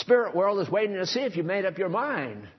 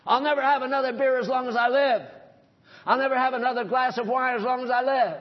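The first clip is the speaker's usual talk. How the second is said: resolute vow; 6 semitones higher, faster than usual. Shown as a demonstration. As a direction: swear it with fire. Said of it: "make up your mind".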